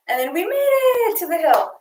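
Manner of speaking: panting after running in place; breathless, puffing between words; out of breath. Real, not acted. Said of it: "Coach is out of breath".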